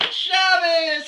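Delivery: high, loud, drawn-out greeting; over-friendly shouted greeting. Retold or imitated. Very friendly shul-goer greeting others, high loud shout. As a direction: holler it slowly across the street in mock friendliness. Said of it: "The very friendly ones".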